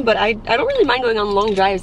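usual speaking voice

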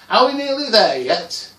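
high, loud whine of impatience; loud, exasperated back-seat cry; impatient child's shout. Acted. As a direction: yell it like a fed-up kid on a long drive. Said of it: "I was just about to shout".

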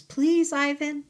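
put-on high, loud child's begging; pleading. Baby elephant Ruby begs Ivan for a story, high and pleading. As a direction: beg him like a little child.

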